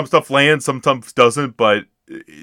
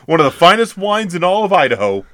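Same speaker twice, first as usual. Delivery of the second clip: high, loud comic proclamation; hammed-up movie quote; 9 semitones higher, 8 dB louder, faster than usual. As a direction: proclaim it grandly, pitched way up. Acted quote from the film.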